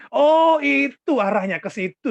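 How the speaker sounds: loud, high, drawn-out "oh" of understanding; acted dawning realisation. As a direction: replay the moment the penny drops. Acts out sudden realisation of offer.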